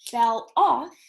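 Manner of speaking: ordinary delivery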